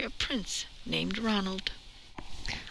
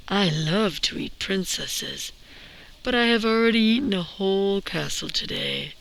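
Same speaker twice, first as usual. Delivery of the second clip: lazy dragon; 4 semitones lower, 4 dB louder than usual. Acted. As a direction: boom it out like a bored, self-important dragon. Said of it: "The dragon stuck his nose out of the door and said".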